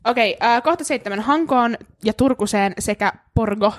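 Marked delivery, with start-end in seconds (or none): 1.2-3.7 slow reading, "Porgo" with a Swedish O; place names read out. Shown as a demonstration. Host reading bucket-list places aloud, slow and careful. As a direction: sound out "Hankoon ja Turkuseen sekä Porgo" slowly. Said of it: "Porgo, P, ruotsalainen O".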